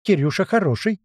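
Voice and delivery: high, wheedling bird voice; squeaky parrot. A narrator's reading. Parrot nuzzling its owner, squeaking. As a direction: pipe up like a parrot seeking affection. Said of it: "и пропищал".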